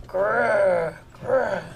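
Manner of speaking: drawn-out storybook bear growl. Shown as a demonstration. Teacher shows pupils a changed voice, bear growl. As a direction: growl each Grr like a bear. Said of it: "how my voice changes".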